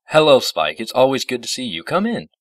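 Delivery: loud, high, hearty greeting; hearty welcome. A narrator's reading. Discord inviting Spike inside, loud and welcoming. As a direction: greet an old friend heartily.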